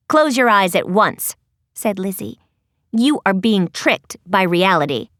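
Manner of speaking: low, firm commanding tone; bossy girl voice. Acted. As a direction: order them sternly. Lizzie commanding.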